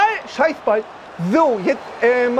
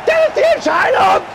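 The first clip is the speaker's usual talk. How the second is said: rising to a screaming cheer; goal celebration; 8 semitones higher, slower than usual. Real, not acted. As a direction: scream with joy. Urging and scoring a goal.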